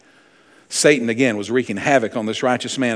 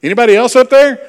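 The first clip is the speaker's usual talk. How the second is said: much higher, much louder yell for anyone else; panicked call upward; 11 semitones higher, 10 dB louder, faster than usual. Retold or imitated. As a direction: shout up in comic panic. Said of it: "joke punchline, man calls again".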